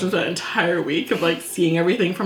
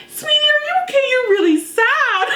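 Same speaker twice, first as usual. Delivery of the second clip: much higher, sugary grandma voice; sweet old lady. Retold or imitated. Bus helper comforting a crying girl, sugary high grandma voice. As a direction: coo it like a kindly grandma at the bus.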